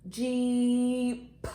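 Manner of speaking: JEEP held long with the end P set apart; long vowel, then a little puffed P on Jeep; stretched-out word. Shown as a demonstration. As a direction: stretch Jeep to show its last sound. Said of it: "what sound do you hear right here".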